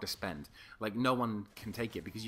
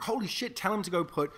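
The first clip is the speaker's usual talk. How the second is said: excited friend; 9 semitones higher, 4 dB louder than usual. Retold or imitated. Girlfriend's friend reacting to the news.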